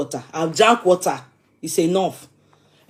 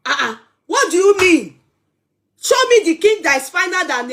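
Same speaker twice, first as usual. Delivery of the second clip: higher, louder indignant challenge; mock angry; 6 semitones higher, 4 dB louder than usual. Daring the chat to name a finer king, mock angry. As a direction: fake outrage, pushing back hard. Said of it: "you people can say I've been gentle since".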